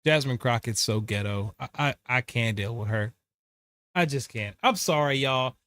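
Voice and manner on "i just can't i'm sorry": ordinary delivery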